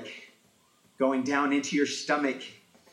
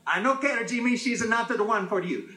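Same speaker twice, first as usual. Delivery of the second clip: high, loud final verdict; mom voice; 8 semitones higher, 4 dB louder than usual. Retold or imitated. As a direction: cut him off as the stubborn mother, pitched high. Mom overrules him.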